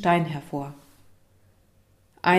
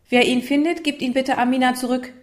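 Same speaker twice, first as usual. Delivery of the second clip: higher, raised classroom voice; teacher's announcement; 6 semitones higher, 5 dB louder than usual. Acted. Teacher asks the class to return the stone, calling out. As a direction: project the request to all the pupils.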